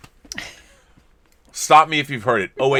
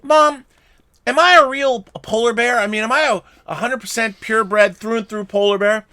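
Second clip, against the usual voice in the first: put-on much higher, childlike cub voice; baby polar bear; 9 semitones higher, 5 dB louder than usual. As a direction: plead like a small anxious cub. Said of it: "goes to his mother and says".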